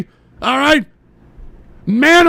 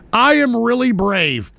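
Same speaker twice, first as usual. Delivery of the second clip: ordinary delivery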